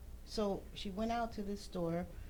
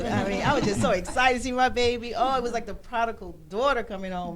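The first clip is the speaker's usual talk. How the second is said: louder, gushing with joy over her homecoming; excited; 4 semitones higher, 9 dB louder than usual. Real, not acted. Mother recalling daughter's return, excited and louder. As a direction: let the joy burst out, louder.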